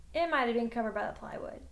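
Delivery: ordinary delivery, nothing marked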